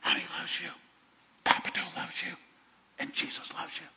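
hushed, unvoiced loving whisper. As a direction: whisper into a baby grandson's ear. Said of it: "I'd whisper in his ear".

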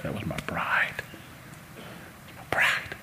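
words caught in the throat, breathed out; choked-up whisper. Real, not acted. Pastor overcome remembering his bride, whispered and choked. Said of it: "I'm not supposed to be a mess up here like this".

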